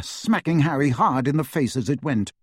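usual speaking voice

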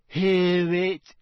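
put-on high, loud ghost girl. Ghost questions Harry as he surfaces, eager call.

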